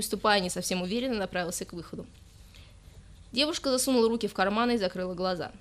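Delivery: ordinary delivery, nothing marked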